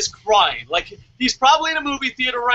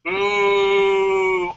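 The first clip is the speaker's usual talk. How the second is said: long, sustained "ooh" on one level note; steady, stretched-out crowd-style ooh; drawn-out ooh. Real, not acted. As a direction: hold out a reacting "ooh". Reacts to co-host's non-apology.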